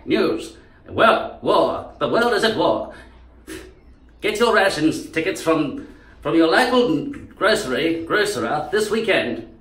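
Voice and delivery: high vintage Australian newsreel announcer; old Australian accent. Acted. Announcer telling public of war, old Australian accent. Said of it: "and even before that".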